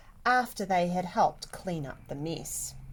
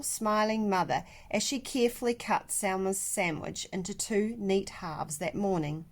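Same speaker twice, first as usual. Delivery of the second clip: plain reading voice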